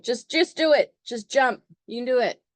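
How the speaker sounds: eager coach pushing hard; pushy Aries. Acted. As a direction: goad someone into jumping. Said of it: "Aries is like".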